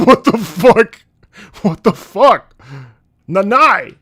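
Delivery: high, loud, laughing disbelief yelling; a loud high shriek of disbelief; shocked outburst. Retold or imitated. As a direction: scream in laughing shock at the mother-in-law. Shocked outburst at mother-in-law.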